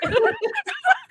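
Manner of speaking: high tearful laugh; laughing through tears; laugh-cry. Real, not acted. Laughs while crying on stream.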